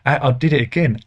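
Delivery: ordinary talk, nothing marked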